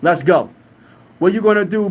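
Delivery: ordinary talk, nothing marked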